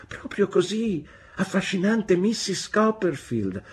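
high-voiced child insisting; firm insistence. A narrator's reading. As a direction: insist brightly, well up in pitch. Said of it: "ripeté con fermezza". (insistenza ferma, con voce acuta di bambino)

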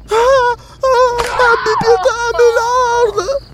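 very high pained plea for mercy; child crying out. A narrator's reading. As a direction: cry out in pain and beg, as a little girl. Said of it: "gridava la bambina".

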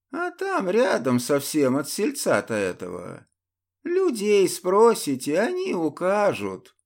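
высокий, неспешный голос старика; рассказывать как седой дед; стариковский голос. Acted. The old man answers. (стариковский голос)

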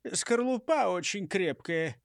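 usual speaking voice

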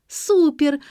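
high excited squeal. A narrator's reading. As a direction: squeal with delight. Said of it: "взвизгнула Нелли".

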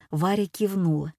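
ordinary delivery

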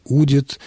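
Удит with the stress on У; stress on root. Shown as a demonstration. Expert shows the personal form, Удит stressed on У. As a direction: hit the first syllable of Удит. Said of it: "ударение на корне".

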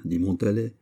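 plain reading voice

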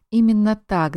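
ordinary delivery, nothing marked

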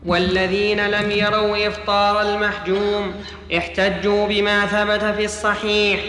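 normal talking voice

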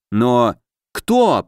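understated angry growl; growled question. A narrator's reading. Ally demands who did the killing, growling. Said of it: "прорычал Алёша".

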